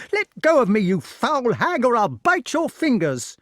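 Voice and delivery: very high, loud desperate cry; shouting boy. A narrator's reading. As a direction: scream it while struggling. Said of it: "he cried".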